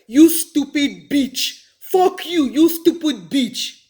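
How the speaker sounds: loud, high, drawn-out insult with bitch as "beach"; old mispronounced curse. Retold or imitated. Re-enacting her schoolgirl insult to kids, bitch said as "beach". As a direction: hurl it slowly, saying bitch as "beach". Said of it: "I would say something like".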